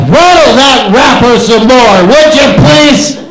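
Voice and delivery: loud mock-polite jab at the audience; loud callout. Real, not acted. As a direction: call out the wrapper rattler. Calling out a noisy audience member.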